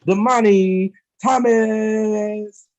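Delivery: slow build to a loud shouted name; hype announcer. Real, not acted. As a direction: drag it out, then blast the winner's name. Host announces third-place winner.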